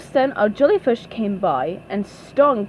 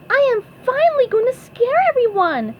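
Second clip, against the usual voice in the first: very high, fast, eager squeak; SpongeBob voice; 4 dB louder, faster than usual. A narrator's reading. SpongeBob plans to scare everyone, high and excited. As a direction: race through it in a high cartoon voice. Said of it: "said SpongeBob".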